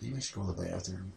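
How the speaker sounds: low, very soft, quick aside; retold inner aside. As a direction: think it aloud under your breath. Retold private thought, a doubt.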